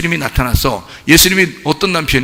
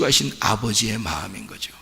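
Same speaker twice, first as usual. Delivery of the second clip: whisper; 6 semitones lower, 10 dB quieter than usual. Real, not acted. Father's grief on the cross.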